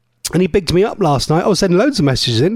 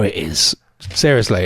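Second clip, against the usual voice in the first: usual speaking voice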